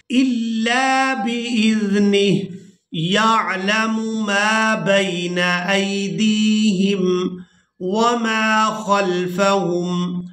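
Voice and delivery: slow, melodic tilawat, drawn out; Quran recitation. Shown as a demonstration. Teacher reciting the verse to students, slow sung tilawat. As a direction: chant each word long and sung.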